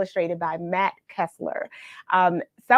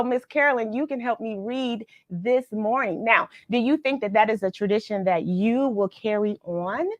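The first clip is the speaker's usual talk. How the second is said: usual speaking voice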